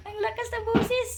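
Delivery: "Ang lakas ng boses" said up high and playful; high bright "boses" with a playful lift; high teasing voice. Real, not acted. Remark on the loud pet.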